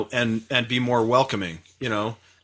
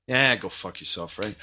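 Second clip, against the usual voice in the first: put-on weak Southern accent, lower and softer; Southern accent attempt; 6 semitones lower, 5 dB quieter than usual. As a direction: try a drawling Southern voice. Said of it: "I can do a Southern accent".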